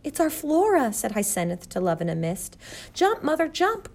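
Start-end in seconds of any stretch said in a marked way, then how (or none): 0.0-0.9 high, excited exclamation; astonished mother spotting her child; excited recognition. A narrator's reading. Mother spots her daughter. 2.9-3.9 high, urgent call; pitched way up, pressing; urgent cry. A narrator's reading. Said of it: "cried Flora".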